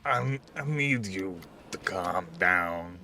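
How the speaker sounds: soft, slow, calming voice; calm reassuring. Acted. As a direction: talk him down gently. [name] calming the feral player.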